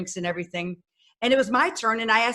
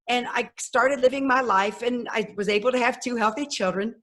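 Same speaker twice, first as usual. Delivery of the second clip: ordinary delivery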